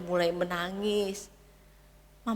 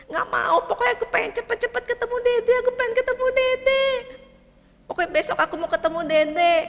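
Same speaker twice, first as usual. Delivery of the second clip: put-on high, loud, fast child's whine; a stubborn boy insisting, near tantrum; whiny little boy; 13 semitones higher, 7 dB louder, faster than usual. Anak merengek ingin ketemu adik. (anak kecil merengek keras)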